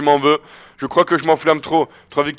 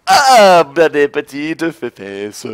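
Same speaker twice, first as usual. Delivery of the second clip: mocking drawl; 2 semitones lower, much slower than usual. Real, not acted. Mocking the beaten opponent.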